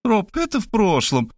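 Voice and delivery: put-on high girlish insistence; pleading young woman. A narrator's reading. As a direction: brush off his objection, pitched high. Girl pleading during frantic chase. (высокий девичий голос, настойчиво)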